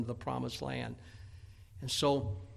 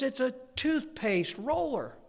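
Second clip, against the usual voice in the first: put-on high, slow kid's announcement; cocky kid; 11 semitones higher, slower than usual. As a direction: announce it slowly like a proud little boy. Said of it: "kind of cocky kid".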